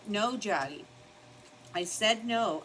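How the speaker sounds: stern no to a pushy dog; scolding the dog. Real, not acted. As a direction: tell the dog off sharply.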